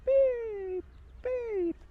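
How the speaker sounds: very high, loud contented chick chirps; happy bird beeps. Acted. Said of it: "He was really happy".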